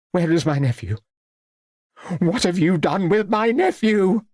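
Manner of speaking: high-pitched agitated demand, rising; shouting. A narrator's reading. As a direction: demand answers, climbing to a high cry. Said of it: "shouted Lady Cleves".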